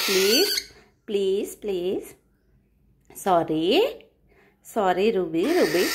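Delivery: slow, hushed please, please. Real, not acted. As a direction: beg tenderly, barely above a murmur. Mother pleading with crying pet.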